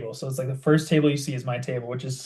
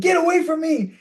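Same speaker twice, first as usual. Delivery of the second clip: high loud yell; 5 dB louder than usual. Retold or imitated. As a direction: bark him away, high and loud. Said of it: "I just yelled at him".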